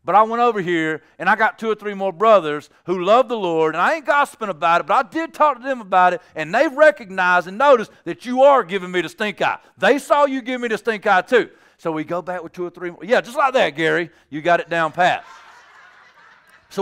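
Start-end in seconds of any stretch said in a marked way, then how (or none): 0.1-11.5 acted confrontation. Acts out confrontation backed by witnesses.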